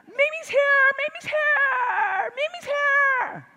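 high, loud, stretched calls of "Mimi's here!"; excited kids screaming. Retold or imitated. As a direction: call it out like thrilled grandkids at the door. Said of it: "they come running out of the house screaming".

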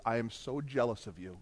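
imitated confession, soft and low; subdued, contrite murmur; tearful confession. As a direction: confess quietly, voice dropped. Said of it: "he starts to cry".

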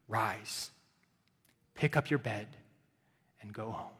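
gentle command. Acted. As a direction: say it gently to the man. Jesus' command spoken softly.

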